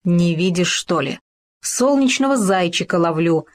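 ordinary delivery

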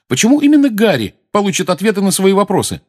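high, loud, angry challenge; angry question. A narrator's reading. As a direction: demand it crossly, pitch jumping up. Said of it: "сердито спросил Фред". (сердито)

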